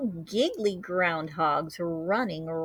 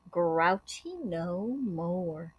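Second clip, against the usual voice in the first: ordinary delivery, nothing marked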